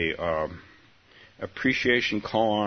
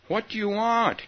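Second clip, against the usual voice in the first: sleepy voice; 6 dB louder than usual. Acted. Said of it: "came a sleepy voice".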